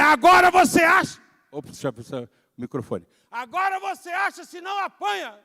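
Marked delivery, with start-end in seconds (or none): 0.0-1.2 yelling hard and high at the top of the voice; furious shout. Retold or imitated. Father shouting at his son to search the trash, yelling. 3.3-5.4 high, shouted threat; angry shout. Retold or imitated. Father threatening his son over the trash, shouting.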